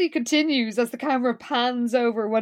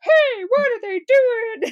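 much higher, louder, slow indignant bystander; background crowd voice; 12 semitones higher, 5 dB louder, slower than usual. Retold or imitated. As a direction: call out like an outraged extra. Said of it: "everybody's just like".

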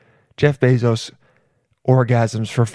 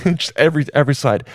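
ordinary talk

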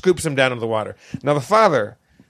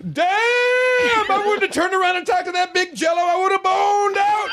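much higher shouted advice to the screen; declaiming shout. Retold or imitated. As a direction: jump up and bellow at the screen. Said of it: "Goes to his feet and says".

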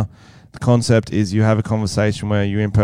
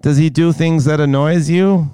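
American girlfriend impression; 6 semitones higher than usual. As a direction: draw it out in a higher girlfriend voice. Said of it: "he has to impersonate his mysterious American girlfriend".

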